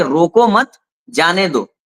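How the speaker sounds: रोको मत together, then जाने दो; pause after मत. Shown as a demonstration. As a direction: break right after मत, setting जाने दो apart. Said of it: "उन्होंने ये समझ लिया कि". (रोको मत के बाद विराम, फिर जाने दो)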